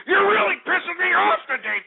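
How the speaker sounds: ordinary talk, nothing marked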